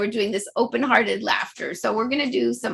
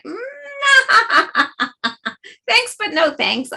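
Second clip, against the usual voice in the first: higher, laughing polite refusal; protecting your heart; 9 semitones higher, 4 dB louder, much slower than usual. Shown as a demonstration. Teacher demoing a laughing no thanks, polite. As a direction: decline with a light laugh. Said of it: "protecting your heart".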